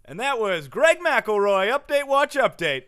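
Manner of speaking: slow, loud, high sign-off; mock segment announcer. Host closing the Greg McElroy segment, mock announcer. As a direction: boom the title out, dropping on the last word.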